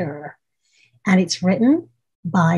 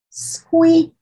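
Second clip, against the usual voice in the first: high, tiny squeaky noise; high squeak; 10 semitones higher, 3 dB louder than usual. Acted. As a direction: squeak like a little mouse. Imitating the squeak sound.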